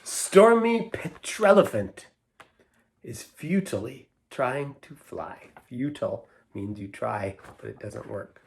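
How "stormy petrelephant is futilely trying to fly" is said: lilting, rhythmic storybook reading; poem read aloud. Acted. Reader sharing a poetry book with children, sing-song rhythm. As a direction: read the rhymes with a playful bounce. Reads rhyming poem to kids.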